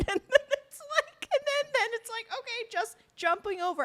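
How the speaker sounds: squeaky, high laughing speech; cracking up while explaining. Real, not acted. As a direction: talk through real laughter, voice way up. Laughing at the abrupt scene jump.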